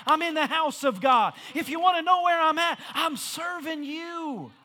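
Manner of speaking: fervent call-and-answer preaching, questions pitched high; impassioned preaching. Acted. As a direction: offer yourself to God at full pulpit force. Preaches as a cry to God.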